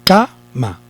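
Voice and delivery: CA-ma with CA punched; stress on first syllable. Shown as a demonstration. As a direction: hit CA in "Cama", pause, drop ma. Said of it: "ahí es donde está el acento".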